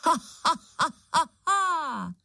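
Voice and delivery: high, ringing evil laugh; ghastly witch cackle. A narrator's reading. Witch's laugh ringing through the ruins, ghastly cackle. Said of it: "Příšerně rozlehlo se zříceninami".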